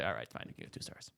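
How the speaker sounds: low, very soft retold surrender; resigned giving in. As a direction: mutter it as a worn-out concession. Worn-down inspectors finally give in.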